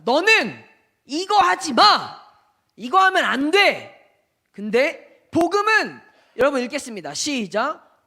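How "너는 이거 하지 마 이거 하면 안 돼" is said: loud, slow, forbidding command; loud scolding voice of the law; stern law voice. Acted. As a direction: lay down the rule like a strict master. Voicing the law's commands.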